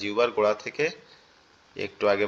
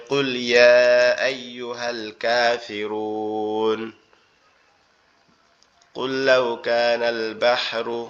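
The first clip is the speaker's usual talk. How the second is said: 1 quran recitation; much slower than usual. Shown as a demonstration. Quotes Quran verses as examples.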